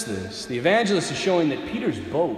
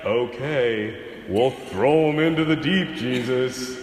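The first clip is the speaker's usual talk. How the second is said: mock grudging sing-song; lightly sung, just humoring him; humoring half-heartedly; 4 semitones lower, slower than usual. Peter humoring Jesus, grudging sing-song. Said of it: "almost doing it to humor Jesus".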